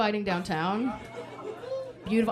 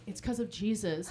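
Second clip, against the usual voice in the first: hushed pastor; 3 semitones lower, 11 dB quieter than usual. Retold or imitated. Pastor leans over whispering.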